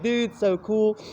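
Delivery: high, loud stoked dude. Retold or imitated. Gardener reacts to the diner, stoked. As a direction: gush like a surfer dude. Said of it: "And he's like".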